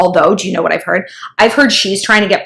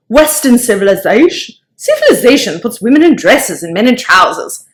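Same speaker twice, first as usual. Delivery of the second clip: Australian accent impression. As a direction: play the Aussie rocker, cheeky and blunt. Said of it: "I'm getting a little Aussie".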